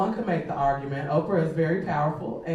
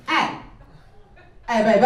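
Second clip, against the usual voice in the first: imitating catcall; 4 semitones higher, 6 dB louder than usual. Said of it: "He's getting closer".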